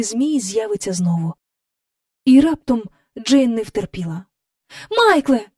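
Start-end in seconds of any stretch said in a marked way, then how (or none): 4.9-5.5 higher call of a name; cry out. Acted. Jane calls to Michael, a raised cry. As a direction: call out to her brother in alarm. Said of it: "скрикнула вона".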